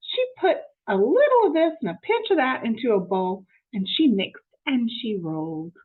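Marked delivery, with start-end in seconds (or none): none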